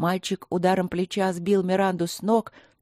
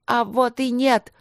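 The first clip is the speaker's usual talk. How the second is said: high loud defiant yell; 5 semitones higher, 5 dB louder than usual. A narrator's reading. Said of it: "завопил Майкл". (громкий крик)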